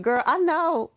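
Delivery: exasperated retort. Retold or imitated. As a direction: fire it back like a fed-up mom. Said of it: "And I'm like".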